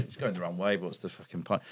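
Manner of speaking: low, soft grumble, retold; resigned muttering. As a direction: mutter it to yourself, giving up.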